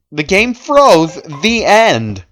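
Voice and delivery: ordinary delivery, nothing marked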